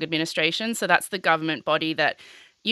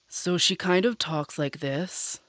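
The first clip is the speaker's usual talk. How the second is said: Elizabeth Holmes; 2 semitones lower than usual. Shown as a demonstration. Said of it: "I'll try and do it now".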